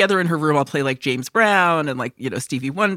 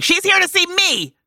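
high mocking voice; 14 semitones higher, 4 dB louder than usual. Mimicking grandma in a high voice.

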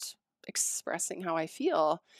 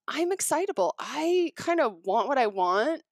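higher-pitched, emphatic excitable talk; 5 semitones higher, 4 dB louder than usual. Shown as a demonstration. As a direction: show how worked up and driven you get. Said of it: "and I talk like this".

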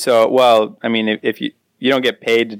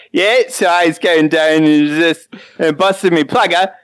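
slurred, higher imitation Australian accent; unintelligible Australian; 5 semitones higher than usual. Cohost imitating a man interviewed on Australian news, garbled accent. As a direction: mimic a barely intelligible Australian interviewee. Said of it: "it was just unintelligible".

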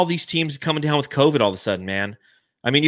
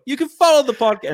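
put-on high, hammy British accent; posh British twang, overdone; 12 semitones higher, 3 dB louder than usual. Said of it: "gonna go British here".

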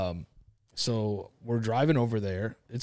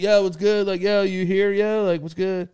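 high, loud mock yell through the house; retold calling out; 8 semitones higher, 7 dB louder than usual. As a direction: call out loudly, as if hunting for someone upstairs. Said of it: "yelling this person's name out".

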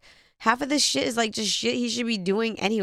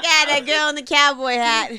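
put-on Boston accent; 4 semitones higher, 6 dB louder than usual. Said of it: "why is he from Bro-, from Boston?".

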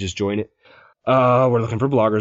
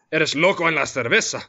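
Spanish with a light Scottish accent, slightly raised pitch; 4 semitones higher than usual. Shown as a demonstration. Host shows off a bilingual punchline, light Scottish-accented Spanish. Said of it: "in Spanish in a Scottish accent".

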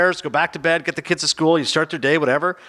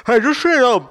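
higher, mock food-stuffed talking; imitated mouth full; 8 semitones higher, 4 dB louder than usual. Colleague chatting while crushing a cheeseburger, mock full mouth. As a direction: talk as if through a mouthful of burger. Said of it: "eating with your mouth full".

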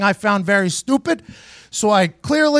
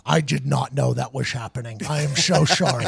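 quiet sheepish apology; 8 semitones lower, 6 dB quieter than usual. Retold or imitated. Chuck apologizing on phone.

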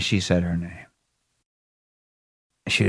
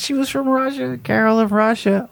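very high mock Russian woman's accent; 13 semitones higher than usual. Comic insisting on the date's origin, thick Russian accent. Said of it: "she had a really thick accent".

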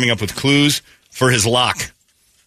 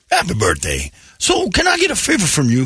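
high put-on voice of a salesman asking a favor; imitating salesman Steve; 10 semitones higher than usual. To John, the salesman asks a favor in a high mock voice. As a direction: squeak out a sly request. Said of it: "big tall guy's name who never said hello".

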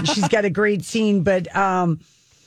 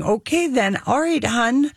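lightly put-on Minnesota "Okay, then", higher; Minnesota accent; 6 semitones higher than usual. Shown as a demonstration. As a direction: demo the Minnesota phrases with a lilt. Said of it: "get into the thing".

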